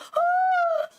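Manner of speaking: a sharp, high, loud gasp acted out; loud imitated gasp. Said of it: "I didn't think I was like".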